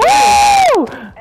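surprised squeal. Real, not acted. Loud squeal in game.

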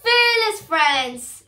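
high, loud, drawn-out rallying call; squawking parrot. A narrator's reading. Parrot leader rallying his animal friends, squawking. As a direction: squawk it slowly to rally the crew. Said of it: "squawked Jim".

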